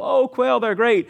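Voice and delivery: high, eager mock enthusiasm; mock delight. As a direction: play a crowd briefly thrilled. Acting out people delighted by quail.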